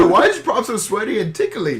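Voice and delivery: laughing mock voice. Echoing the joke voice.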